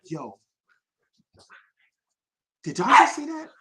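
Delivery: high, astonished question; stunned, can't believe it; amazed. Retold or imitated. Crew asking each other after [name]'s one take, high and astonished.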